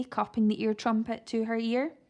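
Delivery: plain reading voice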